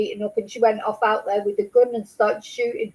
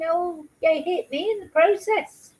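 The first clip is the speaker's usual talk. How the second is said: mocking impression; 7 semitones higher than usual. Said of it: "she's saying".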